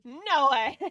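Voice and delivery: loud, indignant no way; loud protest. Real, not acted. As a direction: shout down the idea. Protesting the French joke.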